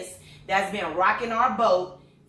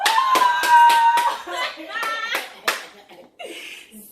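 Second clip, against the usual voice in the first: high joyful laugh; 8 dB louder than usual. Real, not acted. Mom laughing with joy over daughter's news, high delighted laugh.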